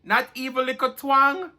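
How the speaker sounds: high, animated Jamaican patois scolding. Acted. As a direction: tease him in Jamaican patois, pitched well up. Reading a Jamaican patois line.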